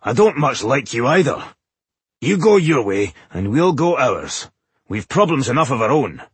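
mildly blunt, pitched a little high. A narrator's reading. Boindil turning the visitors away, mildly blunt. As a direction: send them off with a touch of bluntness. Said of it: "decided not to beat around the bush".

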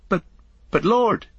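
high, stumbling protest; startled hobbit stammering out. A narrator's reading. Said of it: "Merry stammered".

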